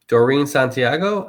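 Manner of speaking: a light Spanish accent on Santiago, san-tee-AH-go; a touch of Spanish pronunciation; Spanish-style name. Shown as a demonstration. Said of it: "I said that kind of Spanish".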